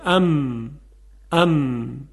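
ام hummed through the nose with closed lips; m with a held nasal hum. Shown as a demonstration. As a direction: let ام ring through the nose. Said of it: "غنه از بینی یا از خیشوم خارج بشه".

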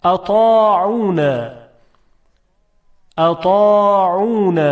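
thick ط in a measured أطاعون; emphatic ta drill. Shown as a demonstration. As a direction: recite أطاعون for students to repeat, long vowels held.

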